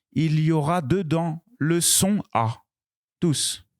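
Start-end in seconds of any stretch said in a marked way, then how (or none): none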